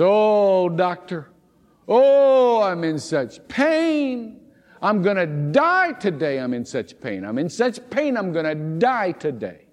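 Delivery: high, hammed-up wail of a complaining patient; moaning patient. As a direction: ham up the dying patient's moaning. Whining patient retold in sermon.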